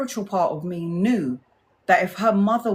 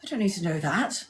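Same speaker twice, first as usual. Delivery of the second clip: posh colleague quiet; 5 dB quieter than usual. Retold or imitated. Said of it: "She's gonna be like".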